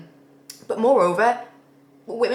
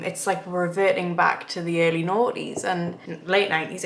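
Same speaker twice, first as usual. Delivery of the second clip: ordinary talk, nothing marked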